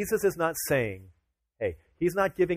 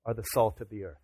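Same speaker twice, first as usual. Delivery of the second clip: ordinary talk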